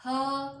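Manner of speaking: her said as a lone, punched 'huh'; isolated h sound. Shown as a demonstration. Teacher giving learners the letter's sound, isolated h. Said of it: "और ह इसका sound है".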